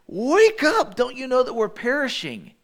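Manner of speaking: high, loud frightened call to wake someone; terrified disciples shouting over a storm; urgent plea that trails down; panicked cry. Retold or imitated.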